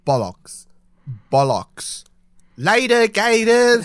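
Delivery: imitating an actor practising a British accent in his trailer; mock British accent. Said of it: "doing the accent, being like".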